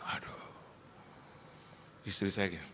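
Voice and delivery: normal talking voice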